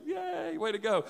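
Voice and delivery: mock cheer. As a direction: token cheer in a small high voice.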